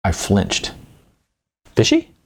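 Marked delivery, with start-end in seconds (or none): 1.8-2.1 shocked high squeak on one word; alarmed repeat of the word; startled echo. Acted. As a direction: caught off guard, voice jumps up. Narrator flinches at accusation.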